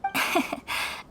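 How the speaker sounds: a light, polite giggle; polite little laugh. Acted. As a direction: laugh the worry off gently. Amused by Kurisu's worry.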